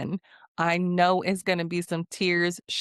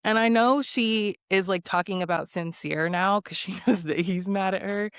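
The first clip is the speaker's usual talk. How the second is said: normal talking voice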